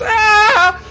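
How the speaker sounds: very high loud cry of pain; pained scream. Acted. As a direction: shriek as the bolt hits the thigh. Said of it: "you just hear this".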